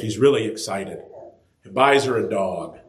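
ordinary talk

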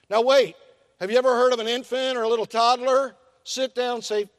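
usual speaking voice

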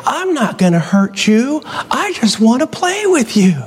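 lightly put-on boyish plea; imitating a little boy. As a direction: coax the animals like a small boy. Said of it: "And he would say".

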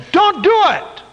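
high, loud stern divine voice; God saying don't do it, high and loud; God's stern command. Acted. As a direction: forbid it with full weight. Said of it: "God says".

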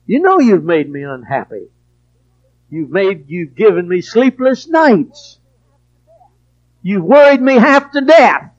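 ordinary delivery

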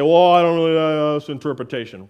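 loud, lightly mocking hedger; mock doubter. Preacher voicing an objector to his reading, mildly mocking. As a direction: blurt it as a caricature of a waffling objector.

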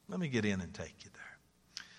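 hushed, voiceless repeat; whisper. Real, not acted. Preacher echoing the offer to the congregation in a whisper.

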